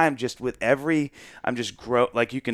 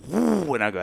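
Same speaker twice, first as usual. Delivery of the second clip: ordinary talk, nothing marked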